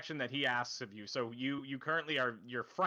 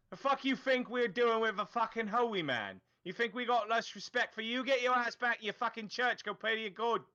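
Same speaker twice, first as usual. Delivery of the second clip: higher, hostile thug snarl; angry thug; 7 semitones higher than usual. Acted. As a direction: spit it at the priest like a street thug. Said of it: "he turns to you and goes".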